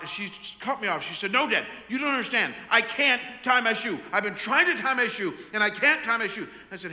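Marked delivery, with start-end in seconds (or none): 1.3-6.5 loud, high, rushed imitation of a toddler's protest; mock a distraught child's tantrum; dramatic screaming. Father imitating daughter cutting him off, dramatic mock screaming. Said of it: "Very dramatic".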